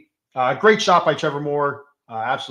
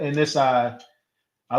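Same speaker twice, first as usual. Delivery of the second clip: usual speaking voice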